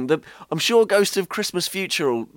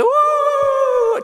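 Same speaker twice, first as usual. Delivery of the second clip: high spooky ghost wail; ghostly woo; 6 dB louder than usual. Acted. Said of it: "Did I say that I was a ghost?".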